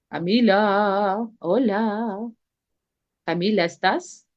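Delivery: long drawn-out call to someone absent; slow, lingering roll-call summons. Real, not acted. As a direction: stretch the name and greeting slowly. Calling an unresponsive student online.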